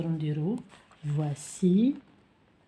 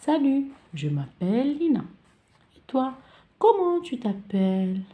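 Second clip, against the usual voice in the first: lightly voiced child; 6 semitones higher, 4 dB louder than usual. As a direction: play a pupil greeting a classmate. Acting a child's dialogue. (صوت طفل خفيف في حوار)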